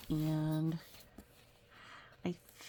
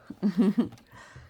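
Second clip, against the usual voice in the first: loud bright laugh; happy laugh; 3 semitones higher, 8 dB louder than usual. Real, not acted. Reader laughing after shouting aha. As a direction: laugh at your own aha.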